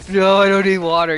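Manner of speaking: loud, stubborn refusal; drunk protest. Acted. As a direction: shout it like a drunk Bruce waving off help. Acted drunk Bruce scene.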